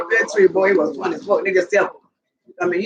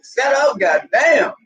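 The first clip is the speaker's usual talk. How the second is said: raised-pitch retold scolding; exasperated mock yell; 6 semitones higher, slower than usual. Owner to all three howling dogs, exasperated mock yell.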